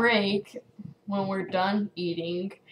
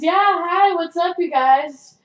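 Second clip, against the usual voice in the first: loud, high over-bright hello; overdone chirpy retold greeting; fake casual greeting; 8 semitones higher, 7 dB louder than usual. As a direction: greet friends too loudly to cover embarrassment. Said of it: "talking to my friends".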